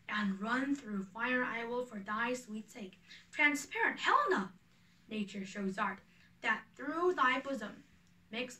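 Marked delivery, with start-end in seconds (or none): 3.3-4.5 sudden high, louder burst of adoration; waking lover's dazzled outcry; rapturous exclaim. Acted. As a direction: cry her name out in love-struck wonder. Lysander wakes, smitten with Helena.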